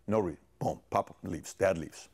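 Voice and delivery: usual speaking voice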